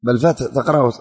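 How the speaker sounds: ordinary talk, nothing marked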